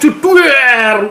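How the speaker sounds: high, loud explosion noise; drawn-out vocal boom; imitated explosion. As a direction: shout out a mimicked blast. Imitates a bomb blast.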